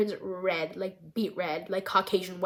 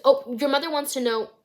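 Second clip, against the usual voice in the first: louder, higher acted entrance line; breezy raised-voice interruption; casual announcement; 5 semitones higher, 7 dB louder than usual. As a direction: call it out walking into a room. Voices stepdad walking in, unaware.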